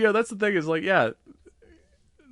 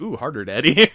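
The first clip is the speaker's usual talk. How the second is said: sultry mock purr; 3 semitones higher, 9 dB louder than usual. Mocking a character's pleased look.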